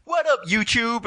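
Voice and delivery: very high, slow shouted YouTube hello; hyped YouTuber greeting. Retold or imitated. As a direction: yell it like an over-the-top YouTuber. Hacker greets YouTube audience.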